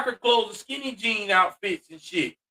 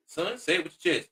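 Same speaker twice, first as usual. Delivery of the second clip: lightly voiced father, lower and softer; 3 semitones lower, 7 dB quieter than usual. Father tells the stammering boy to speak up, low and soft. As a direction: correct the boy in a low, restrained voice.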